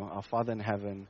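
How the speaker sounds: hushed devotional reading; quiet recited prayer. Shown as a demonstration. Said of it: "we pray".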